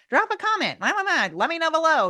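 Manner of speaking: high, sing-song begging for comments; mock pleading. Mocking hosts who beg viewers for comments, high sing-song. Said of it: "you don't have to keep saying".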